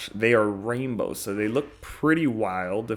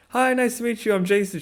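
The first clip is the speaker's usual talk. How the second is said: much higher, overly polite intro; polite self-greeting; 12 semitones higher, 3 dB louder than usual. Retold or imitated. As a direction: sound extra nice meeting her. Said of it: "I'm like".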